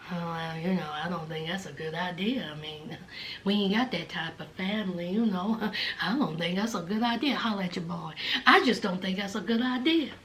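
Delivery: very low, hushed mumbling; mock a man mumbling, words barely leaving the jaw; Harry's mumble. Host mocking a boyfriend turning down a visit, mumbled. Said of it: "who mumbles throughout life".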